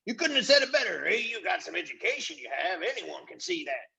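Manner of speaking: high, odd flattering voice, last words dragged out; butter up the chief in a squeaky fawning voice; fawning chorus. A narrator's reading. Voices praise Caspian.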